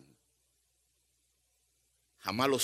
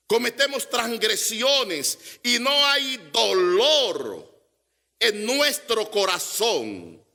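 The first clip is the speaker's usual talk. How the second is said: rising, insistent sermon voice, higher and punching each no es que; preaching; 9 semitones higher, 5 dB louder than usual. Acted. Preacher presses the congregation about why they cry, forceful. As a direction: drive the point home to the congregation.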